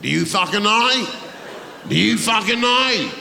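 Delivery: loud, punchy confrontation; mock aggression. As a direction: have a go at a heckler, loudly. Mock row over heckler's contribution.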